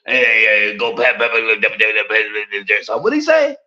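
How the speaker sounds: put-on stammer, then sing it out like an old Southern uncle. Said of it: "uncle down south talk like that".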